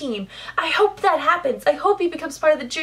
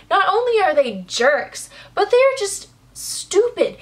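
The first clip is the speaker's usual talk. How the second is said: exasperated rant; 4 semitones higher than usual. Real, not acted. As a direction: vent at the queens' stupidity. Ranting about the stupid queens.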